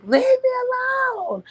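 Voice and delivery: shrieked high and loud, fed up. Retold or imitated. Retelling how she shrieked at her man. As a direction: squeal it out like you've had enough.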